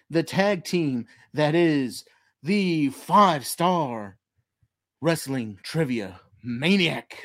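grand showman announcing the act; ringmaster. Acted. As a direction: hype it up like a big-top host. Circus-style show intro continues.

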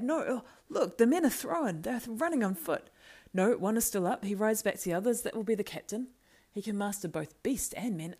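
loud, high, urgent running commentary; excited crying out. Acted. Beregond watching his captain under attack, crying out excitedly. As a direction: call out each turn anxiously as it happens. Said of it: "cried Beregond".

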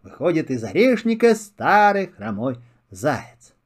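ordinary delivery

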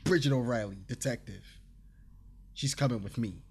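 mock detective introduction, pulling rank; flexing authority. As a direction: state the title like it settles everything. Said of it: "she did the swoop".

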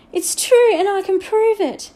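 high, loud protest; desperate insistence. A narrator's reading. Disbelieved boy insists to the headmaster, desperately. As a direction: burst out, insisting on being believed.

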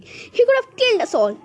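high, loud accusation; outraged scream. A narrator's reading. Grandma accuses the stranger of nearly killing them, screaming. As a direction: shriek it in alarm. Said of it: "screamed Grandpa Josephine".

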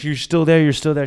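high, loud, urgent repeat; retold frantic check. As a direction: act out panicked checking on someone. Frantic phone call while running.

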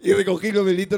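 ordinary delivery

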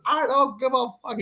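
high-pitched angry retort, retold; mad dad. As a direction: imitate a father past caring. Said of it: "He was so mad".

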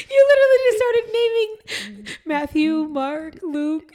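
talking through laughter; breaking up laughing mid-sentence. Real, not acted. Cracking up at name list.

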